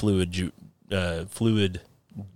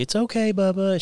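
higher, soothing imitation of the wife; soothing mom voice; 11 semitones higher than usual. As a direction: coo at the little boy to calm him. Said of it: "She's trying to calm him down".

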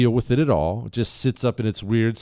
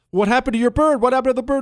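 higher quoted question; movie character asking; 12 semitones higher, much faster than usual. Retold or imitated. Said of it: "They ask the guys".

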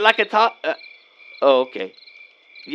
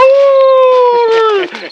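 loud high-pitched laugh; 9 dB louder than usual. Real, not acted. Laughs at own joke.

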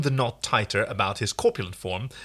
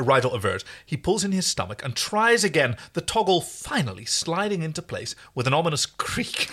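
usual speaking voice

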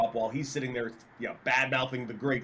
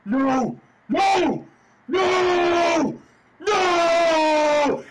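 high, loud screamed no, over and over; retold screaming; 13 semitones higher, 8 dB louder, much slower than usual. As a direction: scream no in anguish, louder each time. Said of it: "you see him start screaming".

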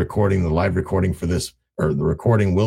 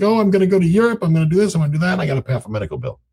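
much higher, eager, listing plans brightly; excited seller; 10 semitones higher, much faster than usual. Retold or imitated. Seller mirrors the buyer's energy, excitedly listing plans. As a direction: bubble over about the trip. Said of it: "They get excited".

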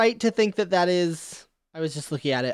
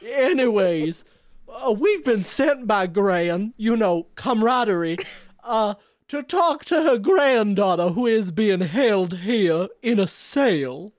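put-on southern accent. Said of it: "I'm doing this accent".

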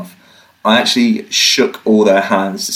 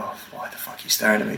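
paranoid whisper; 12 dB quieter, faster than usual. Retold or imitated. Paranoid inner thought, hushed.

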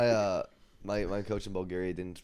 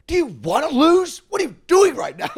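very high, loud shout at a teammate; mock furious yell; 19 dB louder than usual. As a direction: blow up at the libero mid-match. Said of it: "I started freaking".

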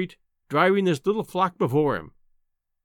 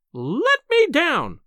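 high, loud, drawn-out wail; slow, high-pitched cry of protest; 11 semitones higher, 5 dB louder, much slower than usual. A narrator's reading. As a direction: wail to be put down. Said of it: "she wailed".

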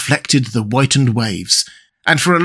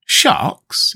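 a breathless, higher startled echo; startled exclamation; 5 semitones higher than usual. A narrator's reading. Aronnax startled by the captain's question, breathless exclamation. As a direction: gasp the word out in alarm. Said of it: "exclaimed I".

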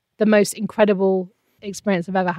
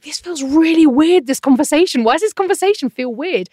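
high, emphatic bewilderment; retold baffled realisation; puzzled; 7 semitones higher, 5 dB louder than usual. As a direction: wonder aloud at the oddness, pitch up. Retelling puzzlement at own conversation.

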